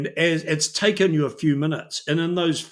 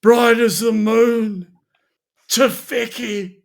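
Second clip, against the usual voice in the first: higher, louder, slow half-sobbing; drunken sob; 6 semitones higher, 7 dB louder, slower than usual. Acted. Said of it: "you feel like he might be just sort of vaguely sobbing as he goes".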